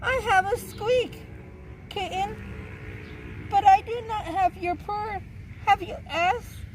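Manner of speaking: high-pitched mouse voice; nervous mouse. Acted. Mouse tells kitten it has no purr, nervous high voice. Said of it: "said the mouse nervously".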